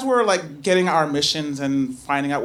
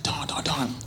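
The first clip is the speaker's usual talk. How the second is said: urgent whispered call; 5 semitones lower, 8 dB quieter than usual. Retold or imitated. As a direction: lean in and whisper his name. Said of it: "Eloise comes over to me".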